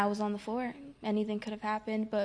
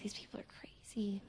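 breathy whispered aside, much softer; 13 dB quieter than usual. Retold or imitated. New girl whispering her suspicion about the cheerful staff. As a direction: whisper it as a private thought.

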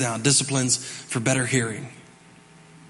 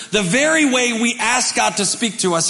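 usual speaking voice

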